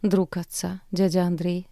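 ordinary delivery, nothing marked

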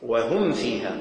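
"وهم فيها" with a clear, short meem in "وهم", no held hum; إظهار الميم. Shown as a demonstration. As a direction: say the meem of "وهم" distinctly, then go straight to "فيها". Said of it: "الإظهار". ("وهم فيها" بإظهار الميم دون غنة)

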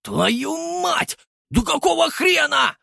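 high, loud panicked shout; panicked yell. A narrator's reading. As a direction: scream it on the edge of panic. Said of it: "На грани паники заорал Санёк".

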